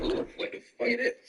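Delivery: breathy high gasp of disgust, recoiling; disgusted reaction. Retold or imitated. Acting out someone smelling funk, disgusted. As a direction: pull a face and recoil at the smell. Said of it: "you make a face like".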